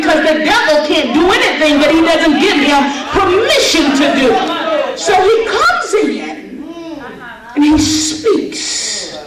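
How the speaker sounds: loud, rising preacher's cry over the music; preaching at full force. Acted. Preacher rousing a women's conference about God's shaking, full force. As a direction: preach it out, stirring the crowd.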